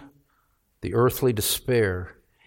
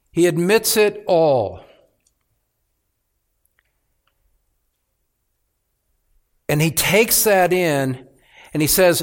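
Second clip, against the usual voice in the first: higher and louder, driving sermon voice; preaching at full force; 7 semitones higher, 5 dB louder than usual. Acted. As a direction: preach it hard, rising with conviction.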